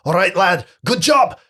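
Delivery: high, loud praise for a lad; bluff, hearty officer voice; hearty commander. Acted. Officer praises Miles after a shoulder punch, hearty. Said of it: "he says".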